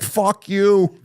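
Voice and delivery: high, teasing imitated comeback; laughing-edged fake taunt; playful mock insult. Retelling his playful retort.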